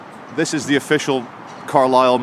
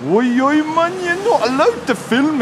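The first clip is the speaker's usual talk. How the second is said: imitating a Geordie accent, much higher; Geordie guard; 12 semitones higher than usual. Mimicking the security guard who ordered him to stop filming, Geordie accent. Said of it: "He sounded a bit Geordie".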